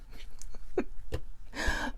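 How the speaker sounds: breathy amused laugh under the breath; a quiet laugh to herself; soft laugh. Real, not acted. Laughing softly to viewers at a quote.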